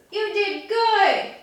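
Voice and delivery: squeaky, sing-song praise for a pet; gushing pet praise. Real, not acted. Praises pet after photo.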